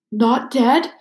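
a choked, catching question; words catching in the throat. Acted. Filch over his petrified cat, half-choked. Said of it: "choked Filch".

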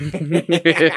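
a quick loud laugh at his own joke; burst of laughter. Real, not acted. Friend laughing at his own joke about the lips, loud laugh.

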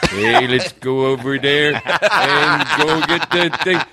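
lightly voiced, slow drunk Canadian John Wayne with a laugh; a hint of a drunk Canadian doing John Wayne, slow. Shown as a demonstration. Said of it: "my impersonation of a drunk Canadian".

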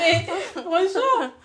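slow words broken by real laughter; laughing. Real, not acted. Laughing to a co-host over a painful memory. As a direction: laugh through it as you start again. Laughing while retelling a hurt.

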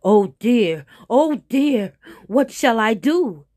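loud, wailing tiger in alarm; alarmed cry. Acted. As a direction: cry out in worried dismay. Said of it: "he cried".

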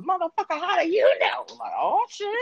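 high, put-on indignant voice; hammed-up fan outrage, pitched high; mocking angry fans. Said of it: "Them motherfuckers".

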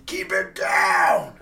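slow, loud crying yell upward; shout. Real, not acted. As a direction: howl it at the ceiling. Yelling at upstairs neighbours again.

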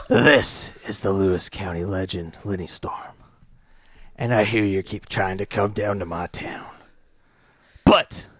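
low, hushed menacing promo voice; a hint of a gravel-voiced heel; wrestling promo. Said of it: "Who are you cutting a promo on?".

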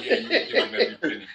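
mock slurred drunk voice, laughing; a laughing drunk impression; imitating a drunk man. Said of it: "you sound like a drunk man about".

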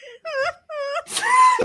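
very high squealing laugh; uncontrolled laugh. Real, not acted. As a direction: burst into a high-pitched laughing fit. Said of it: "Not the laugh".

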